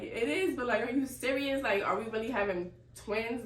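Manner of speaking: excited retold disbelief; reenacted disbelief. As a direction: relive the amazed need to be sure. Retelling her disbelief at twins.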